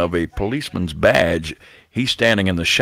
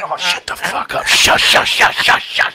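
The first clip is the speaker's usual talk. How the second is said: hissed hush; 10 semitones higher, 3 dB louder than usual. Retold or imitated. Hushing her in the cell.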